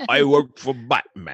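a blended British-Australian put-on voice; mixed-up British Aussie accent, loud; mock British-Australian. Host mangling British and Australian accents for laughs. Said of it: "It's a bastardization of both".

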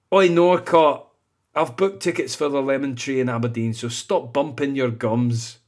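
put-on Scottish accent. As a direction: read the review as a blunt Scot. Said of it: "This might be Scottish as well".

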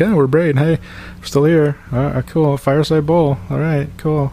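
a tattooed band guy nodding to everyone; mock aging rocker. Mocking the band Braid as a laid-back older rocker. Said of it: "older dude with tattoos at the party".

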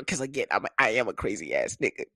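ordinary talk

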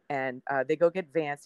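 usual speaking voice

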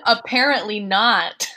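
laughing through the words; slow, loud laughing reply. Real, not acted.

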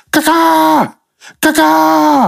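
high cawing bird squawk. Retold or imitated. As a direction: squawk it like a crow outside the window. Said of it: "I just go out there and go".

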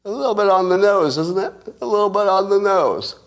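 high, knowing sarcastic lilt. Real, not acted. Sarcastic remark on convenient timing.

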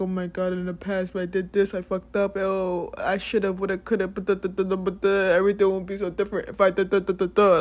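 mock the person who frets over the past; mocking ruminating voice. Mocking people who ruminate on the past, hammed-up fretting. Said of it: "That shit is so lame".

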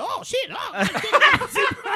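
high-pitched, overexcited imitation of a buddy; Ray Money impression. Comedian mimicking Ray Money scoring a ticket, high and excited. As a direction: squeal it out like your friend just lucked into a ticket. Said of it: "that's an amazing Ray Money".

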